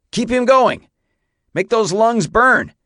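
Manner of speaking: high, loud urging; shouted urging. A narrator's reading. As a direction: drive the rider on with a shout. Coaching rider through runaway.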